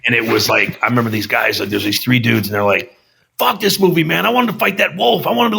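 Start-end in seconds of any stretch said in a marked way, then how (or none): 3.4-5.6 mock angry rant. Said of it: "they were really pissed off".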